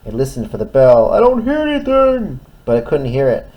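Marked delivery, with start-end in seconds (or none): 1.1-2.4 high, loud frightened cry; panicked in the fog; scared. Acted. Tug lost in fog.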